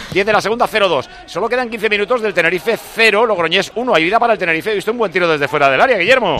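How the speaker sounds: sports radio announcer. Acted. Comentarista, lectura de marcador animada.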